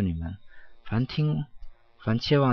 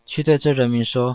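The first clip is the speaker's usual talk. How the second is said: louder, raised voice of command; mighty call; 4 semitones higher, 8 dB louder than usual. A narrator's reading. The Spirit crying out to send him to the people, loud. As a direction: call it out with great force. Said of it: "以极其有力的声音对我呼喊说".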